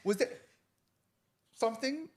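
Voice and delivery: halting, with a high rising last word; smiling woman's hesitant question. Retold or imitated. Lady asking him if he was flirting, hesitantly imitated. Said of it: "That's how she is".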